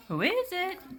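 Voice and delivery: put-on old woman calling out who's there. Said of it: "called out Granny".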